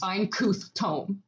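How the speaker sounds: "fine tooth tome" slowly, with tooth said as "couth"; slow swapped sounds, "tooth" as "couth" before "tome"; deliberate mispronunciation. Shown as a demonstration. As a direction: draw out the slip, "tooth" starting with a K. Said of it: "trying to say it wrong".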